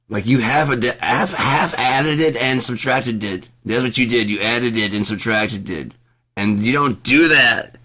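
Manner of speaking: ranting about a clerk's bad subtraction; heated rant. Real, not acted. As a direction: lay into the clerk's bad math.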